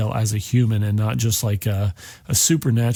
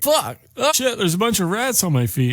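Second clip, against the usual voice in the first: much higher, alarmed outburst; startled yelp; 9 semitones higher than usual. Acted. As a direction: jump as you spot the rats. Stu finds rats on feet.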